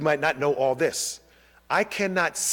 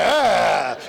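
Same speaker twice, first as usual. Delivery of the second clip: mock shout of disgust; hammed-up disgusted yelp; disgusted exclamation; 3 semitones higher, 6 dB louder than usual. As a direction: imitate a disgusted star recoiling. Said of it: "She's, like, disgusted".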